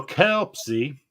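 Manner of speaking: Kelpsy stretched slowly into KELP-see; Kelpsy eased out in two slow syllables; drawn-out pronunciation. Real, not acted. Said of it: "that's the only way I can say it".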